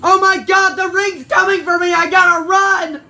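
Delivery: high, loud terrified yelling; panicked shout. Acted. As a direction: scream it out in a panic. Fleeing the ghost.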